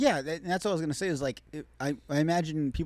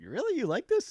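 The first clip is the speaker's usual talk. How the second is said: surprised; 10 semitones higher than usual. Retold or imitated. Retold surprised reply.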